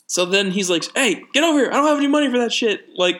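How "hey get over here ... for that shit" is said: high-pitched, quick retold scolding; scolding dad. Dad calls misbehaving kid over, refusing money, mock scolding.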